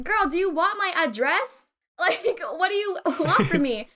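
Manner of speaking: fed up with being quizzed, half laughing; mock exasperation. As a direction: throw up a baffled challenge. Acting exasperated reply to prying.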